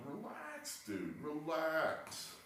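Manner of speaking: soft, slow, high coaxing; soothing relax. Retold or imitated. A teammate calming Halladay, soft and slow. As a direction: ease him down gently. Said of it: "He needed some".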